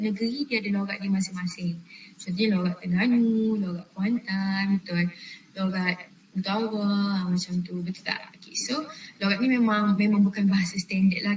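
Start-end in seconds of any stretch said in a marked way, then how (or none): none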